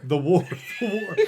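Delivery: repeating it through a laugh. Real, not acted. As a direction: say it while cracking up.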